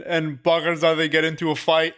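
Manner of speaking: ordinary talk, nothing marked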